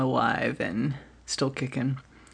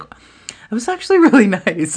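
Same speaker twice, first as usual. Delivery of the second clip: higher, louder with a laugh; pleasantly surprised; 7 semitones higher, 8 dB louder than usual. Retold or imitated. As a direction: let the surprise bubble up. Own surprised thought.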